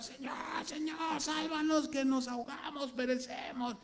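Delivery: high, desperate pleading to the Lord; panicked cries for help. Retold or imitated. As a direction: cry out like sailors sinking in a storm. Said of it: "Y le empezaron a gritar".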